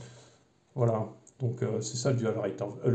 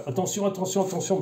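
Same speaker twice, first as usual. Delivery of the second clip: higher-pitched mimicked warnings; mock warning; 8 semitones higher than usual. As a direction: imitate a manual's repeated, fussy warnings. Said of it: "c'est qu'ils te mettent".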